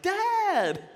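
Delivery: high-pitched, loud mock shout; startled daughter. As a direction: imitate a daughter caught off guard by Dad. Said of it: "this was her face like".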